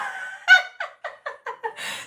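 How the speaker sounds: silent high wheeze of laughter; breathless wheezing laugh. Real, not acted. Laughing at the drawers card, breathless wheeze.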